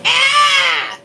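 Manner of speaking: high, far-from-normal mock shriek; startled animal scream, imitated; imitated hog scream. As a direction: let out a piercing hog cry. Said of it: "he said".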